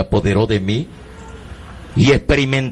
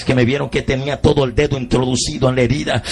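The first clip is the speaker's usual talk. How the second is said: ordinary talk, nothing marked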